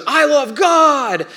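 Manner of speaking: high, loud, drawn-out mock shout; imitated one-upping kid. Another kid one-upping that one, imitated shout. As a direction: belt it out slowly like a competitive child. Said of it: "another kid decides".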